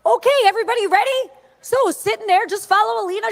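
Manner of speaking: brisk and cheerful, ready to go; quoting Trump. Retold or imitated. Trump rallying his team before court, brisk and upbeat. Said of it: "And he goes".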